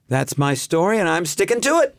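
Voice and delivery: lightly voiced sitcom mom; Marion Ross. Hosts request the line, mild Marion Ross voice. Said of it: "in a Marion Ross accent".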